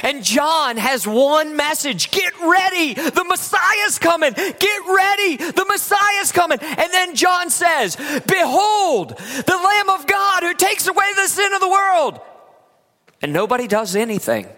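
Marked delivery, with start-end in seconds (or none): 2.1-6.6 prophet's cry. Retold or imitated. John the Baptist's proclamation. 8.0-12.2 prophetic proclamation. Retold or imitated. John proclaims Jesus publicly.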